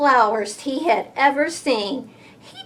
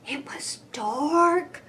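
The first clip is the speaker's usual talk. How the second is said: ordinary delivery, nothing marked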